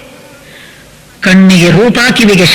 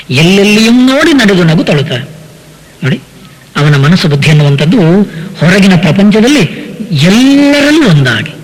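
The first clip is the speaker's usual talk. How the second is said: ordinary talk, nothing marked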